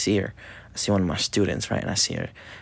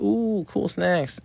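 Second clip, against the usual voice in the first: high, slow impressed ooh; drawn-out mock wonder; talking to a kid; 9 semitones higher, much slower than usual. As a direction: play amazed for a little girl. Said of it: "I was like".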